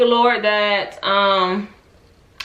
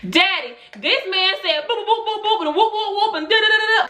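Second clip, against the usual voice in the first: pitched way up, rushed tattling to dad; high excited tattling; 12 semitones higher than usual. Retold or imitated. Daughter tattling to her father on the phone, high pitched. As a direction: spill it all to daddy, high and breathless. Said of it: "I called my father".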